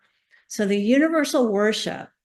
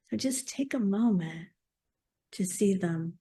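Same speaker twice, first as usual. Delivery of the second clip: guided meditation. Acted. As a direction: guide them gently inward, unhurried.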